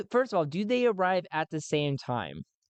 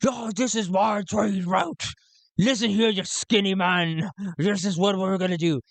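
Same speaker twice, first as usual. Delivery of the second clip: quarrelsome pirate; 5 semitones higher than usual. Acted. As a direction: pick a fight in a put-on pirate voice. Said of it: "going at each other's throat".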